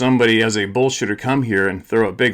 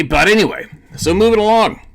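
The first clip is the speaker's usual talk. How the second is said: much higher and louder "moving along"; loud topic change; 10 semitones higher, 6 dB louder than usual. Real, not acted. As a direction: push on loudly to the next topic.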